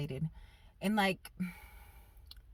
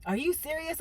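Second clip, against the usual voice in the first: surprised husband; 9 semitones higher than usual. Retold or imitated. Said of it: "He was like".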